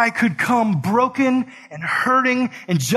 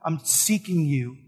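tender; 4 semitones lower than usual. Retold or imitated. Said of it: "Jesus is like".

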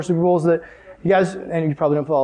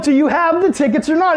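much higher, pressing demand; frustrated; 9 semitones higher than usual. Retold or imitated. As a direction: lose patience with the friend. Frustrated repeat to friend.

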